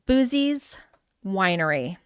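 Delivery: normal talking voice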